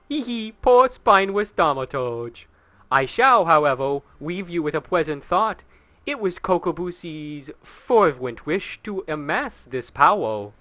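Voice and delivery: mock the beaten hero with relish; taunting villain.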